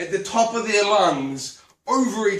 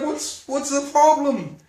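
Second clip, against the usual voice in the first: puzzled, a bit indignant; defensive surprise; 8 semitones higher, slower than usual. Retold or imitated. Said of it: "And I'm like".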